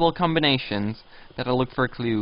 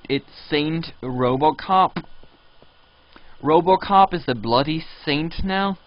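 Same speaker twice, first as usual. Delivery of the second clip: usual speaking voice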